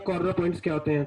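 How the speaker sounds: ordinary talk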